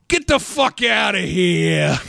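slow, drawn-out mock New York shout-off; girl's line in that accent. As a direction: stretch it out in hammed-up New Yorkese. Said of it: "Read the girl's line in that voice".

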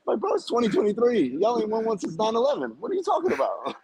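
high-pitched mock outrage; exasperated retold comeback, high; retold incredulity.

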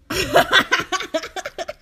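big burst of laughter; loud laugh. Real, not acted.

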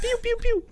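very high, soft pew pew; water pistol sound effect. Retold or imitated. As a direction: toy gun noises.

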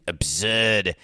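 put-on Boston accent, punched out; Charles Emerson Winchester. Host quoting a TV character, hammed-up Boston accent. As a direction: do a bad upper-crust Boston accent. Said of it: "as Charles Emerson Winchester would say".

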